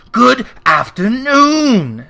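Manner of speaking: a loud, slow, stern goodbye; curt dismissal. Acted. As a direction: slam the door with the words. Scrooge dismissing his nephew again.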